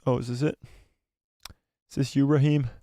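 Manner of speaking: ordinary talk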